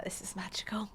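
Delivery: hushed, awed whisper. Retold or imitated. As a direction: breathe it out in wonder. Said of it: "and I was like".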